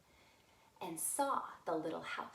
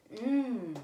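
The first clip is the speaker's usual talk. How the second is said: satisfied mmm; 3 semitones higher, 6 dB louder than usual. Acted. Goldilocks finds right porridge.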